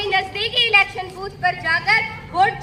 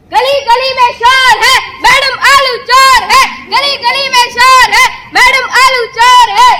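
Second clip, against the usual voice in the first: loud rhythmic street chant; protest slogan; 2 semitones higher, 10 dB louder than usual. Acted. Party supporter accusing rival madam in the streets, shouted slogan.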